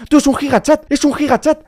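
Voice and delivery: high, loud amazed cry; excited shout. Real, not acted. Gamer reacting to his character looking great, amazed shout. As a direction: yell it in delighted disbelief.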